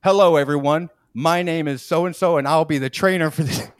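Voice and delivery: loud, bright presenter voice; cheery trainer greeting. Retold or imitated. Trainer reintroducing himself to the room, loud and bright. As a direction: announce it like a host. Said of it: "he goes".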